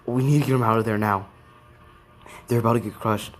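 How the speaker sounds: quick, mildly acted urgency; urgent order. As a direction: retell a hurried order with some alarm. Aizawa urgently orders a rescue.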